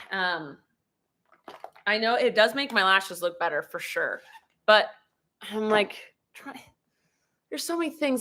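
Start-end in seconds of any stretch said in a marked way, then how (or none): none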